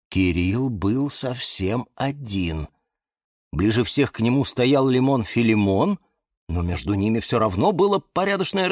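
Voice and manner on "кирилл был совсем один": ordinary delivery, nothing marked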